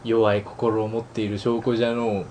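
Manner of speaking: put-on slow, low old-villain drawl. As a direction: play a wise old villain, slowly. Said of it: "なんだこいつ".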